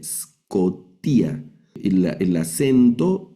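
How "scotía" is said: "scotía" slowly broken up, starting on a bare S; initial S alone. Shown as a demonstration. Teacher showing there is no vowel before S, slow syllabic pronunciation. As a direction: hold the S of "scotía", then each syllable apart. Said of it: "solo suena la S".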